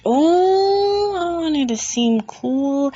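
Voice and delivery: drawn-out, high mock excuse; mocking imitation. Vlogger mimics Ginny's excuse for obliging, mocking.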